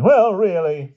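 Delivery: high, loud indignant huff; affronted. A narrator's reading. Owner of the paper called a figment, affronted. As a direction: take offence in a high voice. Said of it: "looking displeased".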